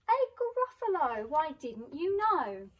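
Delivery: high, loud scoffing disbelief; mock astonished. Mouse scoffs at the snake.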